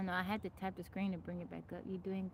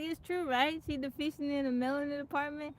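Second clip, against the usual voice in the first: higher, mock-innocent imitation of the friend; friend's teasing; 7 semitones higher, 4 dB louder than usual. Imitating the friend's innocent, higher-pitched question about the bunny. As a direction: ask it like you mean no harm. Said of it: "why you gotta say it like that".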